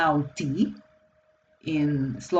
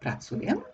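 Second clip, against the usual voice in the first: pracuję with a high rising question tune; 6 semitones higher, 6 dB quieter than usual. Shown as a demonstration. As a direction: ask "pracuję" with the pitch going up at the end. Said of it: "just can omit this pronoun".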